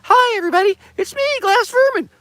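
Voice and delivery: very high, loud cartoon squeak; squeaky glass head voice. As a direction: squeal it like a tiny talking pipe. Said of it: "the Glass Vermin that I call him".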